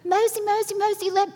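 put-on high, loud, eager child calling for grandma; little granddaughter voice. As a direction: play an excited little Southern girl tugging at grandma. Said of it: "she has very Alabama Southern accent".

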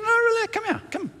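put-on high girlish voice coaxing; high daughter voice. Daughter beckoning the ladies over, high-pitched. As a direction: call them back in a high light voice. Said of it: "she's going".

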